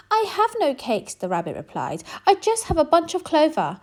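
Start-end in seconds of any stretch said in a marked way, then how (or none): 0.1-1.1 small, much higher rabbit voice; timid rabbit. Acted. Rabbit answering the robber rat, high and small. 2.3-3.8 small, much higher rabbit voice; timid rabbit. Acted. Rabbit answering the robber rat, high and small.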